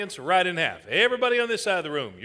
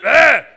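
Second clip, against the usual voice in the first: short loud ah; sudden mob-leader shout; demonstrated yell; 4 semitones higher, 12 dB louder than usual. Shown as a demonstration. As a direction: burst out with a quick yell. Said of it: "Well, just do it".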